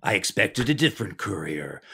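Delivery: a hint of a gravelly trailer narrator; imitating a dramatic trailer voiceover; movie trailer voice. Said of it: "Do you like my movie trailer voice?".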